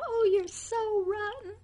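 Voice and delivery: high, sweet sing-song scold; imitating a smiling mother calling her son rotten; said with a smile. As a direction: coo the insult like a fond mother. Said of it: "It was said with a smile".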